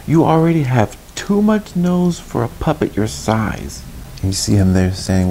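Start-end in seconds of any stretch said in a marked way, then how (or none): 0.1-3.8 high-pitched scolding cricket; high storybook cricket, admonishing; Jiminy Cricket. Acted. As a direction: chide from up high in a character voice. Voices Jiminy Cricket warning.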